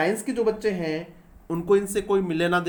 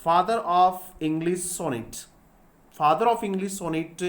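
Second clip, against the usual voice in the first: very slow, every word separated; slow repeated dictation; much slower than usual. Shown as a demonstration. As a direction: dictate the question slowly. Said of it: "और question क्या हो सकता है?".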